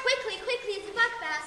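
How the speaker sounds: urgent hurry. Acted. Urgent servant in play.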